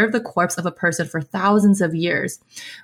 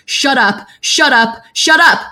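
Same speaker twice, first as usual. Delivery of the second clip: repeated high outbursts, growing louder and higher; Xie Liang's furious scream; 6 semitones higher, 5 dB louder than usual. A narrator's reading. Xie Liang punching Qirong over and over, screaming in rising fury. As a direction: shout each one harder, climbing to the last.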